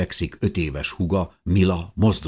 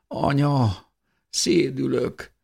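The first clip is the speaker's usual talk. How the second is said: high, slow, weak little voice; whimpering child; 10 semitones higher, much slower than usual. A narrator's reading. Little girl coming round complains to mother, whimpering. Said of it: "nyöszörögte Mila".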